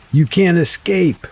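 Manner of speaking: high, loud snarling threat; furious growl. A narrator's reading. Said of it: "now sounding almost like a growl".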